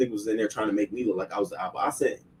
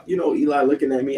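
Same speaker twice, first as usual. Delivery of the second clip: ordinary talk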